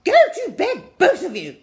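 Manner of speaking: very loud, high exasperated shriek; fed-up aunt yells at the boys. Acted. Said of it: "shrieked Ruby".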